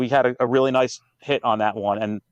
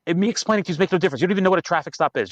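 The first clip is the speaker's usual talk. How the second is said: fast, higher, exasperated lecture; impatient retort; 6 semitones higher, faster than usual. Retold or imitated. Cop fast and impatient, telling the dog handler to skip the questions. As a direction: rattle it off fast to shut him down.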